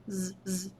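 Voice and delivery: "z" buzzed softly on its own; soft z sound. Shown as a demonstration. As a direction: isolate the final "z" as a quiet buzz. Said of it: "So I'm hearing a Z".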